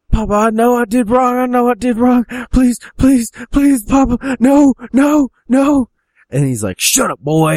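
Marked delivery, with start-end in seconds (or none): 0.1-5.9 very high, loud frantic begging; hammed-up panicked child; pleading son. Son begs father in story. 6.8-7.5 mock angry command, raised pitch; acted stern outburst; angry father. Father silences begging son.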